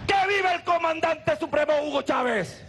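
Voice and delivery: shouted rally chant, pitched high; ranting dictator speech. Real, not acted. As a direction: bellow each slogan to the crowd. (arenga gritada a todo pulmón)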